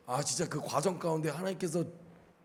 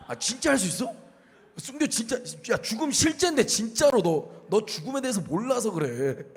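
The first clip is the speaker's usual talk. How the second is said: higher, preachy lecturing; imitating the sister; 5 semitones higher than usual. Mimicking the worship leader sister lecturing, a touch higher. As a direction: lecture like she knows better. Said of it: "근데 저 자매가".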